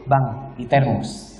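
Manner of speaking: slow, soft, low reply; calm unruffled wife. Acted. Wife pointing husband to the coffee, calmly soothing. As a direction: defuse him gently and slowly.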